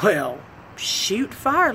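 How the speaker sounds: slow drawled shoot fire; drawn out. Retold or imitated. Agreeing to ride with a slow drawl. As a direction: stretch it out, slow.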